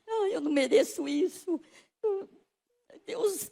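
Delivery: tearful plea. Retold or imitated. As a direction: cry out it's undeserved. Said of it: "Aí eu chorei e falei".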